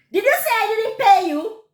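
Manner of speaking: indignant demand; angry challenge. Retold or imitated. Woman confronting market trader who denies payment, angry. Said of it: "So I got angry now".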